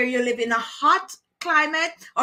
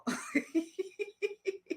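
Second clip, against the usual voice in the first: soft, high giggle; quiet run of high tittering; laughing; 7 semitones higher, 12 dB quieter than usual. Real, not acted. Host giggling over a friend's shower confession, laughing. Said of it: "You see, I'm laughing".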